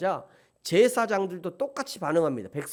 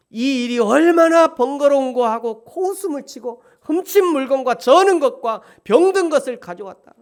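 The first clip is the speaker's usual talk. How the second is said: higher and louder scripture quote; raised reproachful reading; forceful reading; 8 semitones higher, 11 dB louder than usual. Acted. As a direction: proclaim the charge loudly. Reading God's charge forcefully.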